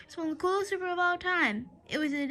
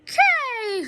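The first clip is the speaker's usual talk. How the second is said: higher, louder crowd answer; crowd cheer; 7 semitones higher, 5 dB louder than usual. Acted. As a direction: yell back like the fans. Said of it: "repeated the fans".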